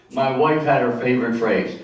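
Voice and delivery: usual speaking voice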